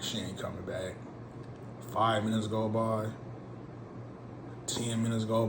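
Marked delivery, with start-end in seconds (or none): 0.0-0.9 softer, lower resigned aside; resigned mutter. Retold or imitated. Waiting at the curb, quiet resigned self-talk. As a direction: say it to yourself, already giving up.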